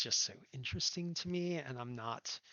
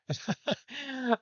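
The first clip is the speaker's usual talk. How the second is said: bright laugh; 13 semitones higher, 9 dB louder than usual. Real, not acted. Raider thanked.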